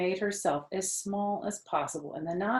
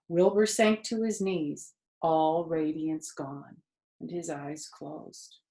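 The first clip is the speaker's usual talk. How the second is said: ordinary delivery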